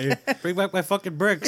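laughing mock old man.

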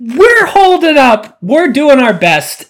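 high, slow, mock-earnest; brave coping reply. Answering the stranger, hammed-up plucky. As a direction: put on a plucky face. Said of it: "I'm like".

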